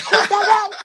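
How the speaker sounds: high, laughing disbelief at a new voice; amazed who's that. Retold or imitated. Hearing the remix, asking who the singer is, high laughing surprise. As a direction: gasp it out, delighted and stunned. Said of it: "I'm like".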